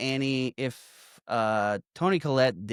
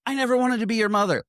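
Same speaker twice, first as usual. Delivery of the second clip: high, loud, rushed retold outburst; acted cry of confession, fast and high; forced-out outburst; 11 semitones higher, 5 dB louder, faster than usual. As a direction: blurt it as if the words escape against your will. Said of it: "forced out of her".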